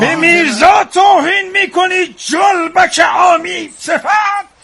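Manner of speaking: angry shout. Acted. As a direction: shout down the insulter. Defending Mirza in quarrel.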